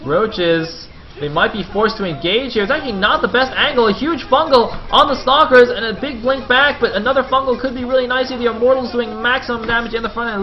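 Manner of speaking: rising esports caster hype; excited play-by-play, building. Real, not acted. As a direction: climb to a high, loud game call. Live call of a big fight.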